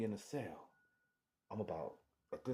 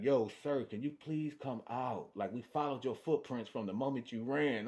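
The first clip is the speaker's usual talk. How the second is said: louder, higher mock police call; cop calling out; 4 semitones higher, 6 dB louder than usual. Cops coaxing him out of the garage, loud call. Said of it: "they came to that garage like".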